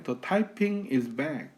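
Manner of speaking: slow, careful American English; American accent demo. Shown as a demonstration. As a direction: model native pronunciation slowly. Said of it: "외국인 그대로 되게 흉내는 거예요". (미국식 원어민 발음 흉내)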